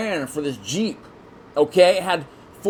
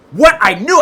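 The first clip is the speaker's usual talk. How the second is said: loud, raised-pitch mock outrage; acted indignation; 7 semitones higher, 11 dB louder, slower than usual. Buyer realizing the salesman misled him, loud acted indignation. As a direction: burst out as if just cheated.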